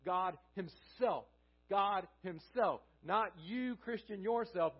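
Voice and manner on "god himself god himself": "God himself" slowly, leaning on "himself"; slow down and land firmly on "himself"; stress on HIMSELF. Shown as a demonstration. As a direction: give "himself" deliberate, weighty emphasis after "God". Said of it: "the emphasis on the word himself".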